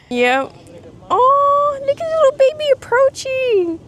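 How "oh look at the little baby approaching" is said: adoring coo. Real, not acted. Vlogger watching the little baby approaching, high adoring coo. Cooing over a baby bird.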